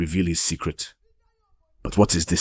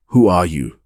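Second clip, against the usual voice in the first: loud, demanding question; sharp challenge; 8 dB louder than usual. A narrator's reading. Officer to driver at a police checkpoint, loud challenge. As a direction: challenge a suspect with a raised voice.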